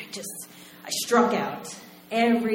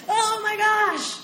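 much higher, slow stretched gasp of awe; starstruck; 13 semitones higher, slower than usual. Retold or imitated. Fan realizing she met the lead singer, starstruck. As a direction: draw it out, overwhelmed fan.

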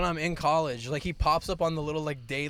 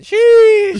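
class going sheesh; 20 dB louder than usual. Retold or imitated. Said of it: "everybody just went".

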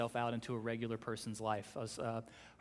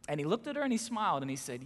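ordinary delivery

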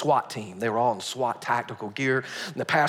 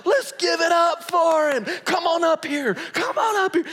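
emcee shout; 10 dB louder than usual. Retold or imitated. Calling mayor on stage.

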